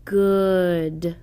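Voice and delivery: Good stretched slowly, sound by sound; drawn-out Good. Shown as a demonstration. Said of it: "Short uh word should be read like this".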